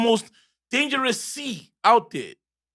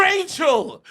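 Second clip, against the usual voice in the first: higher, drawn-out exclamation; exclaiming; 8 semitones higher than usual. Real, not acted. Co-host recalls the ex-wife's name, exclaiming. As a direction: burst out with the name.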